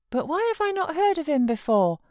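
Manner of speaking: put-on a fretful child pleading to stay; sickly child. A narrator's reading. Boy refusing to leave his uncle, high fretful whine. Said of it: "asked Linton".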